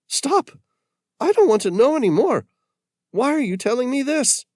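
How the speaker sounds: high, loud, fast panicked protest; frightened plea. Acted. Yukitono begging Patrick to stop, panicked and loud. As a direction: cut him off in alarm.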